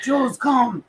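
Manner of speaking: calling the dog. Retold or imitated. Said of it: "I was like".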